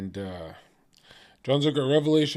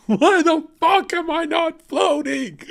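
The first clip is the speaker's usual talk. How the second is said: much higher, louder, slow shout broken by laughter; hammed-up furious shout; 6 dB louder, slower than usual. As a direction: bellow it in mock outrage, laughing. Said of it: "I'm gonna be like".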